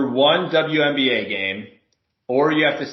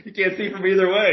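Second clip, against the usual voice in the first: normal talking voice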